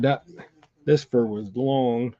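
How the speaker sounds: ordinary talk, nothing marked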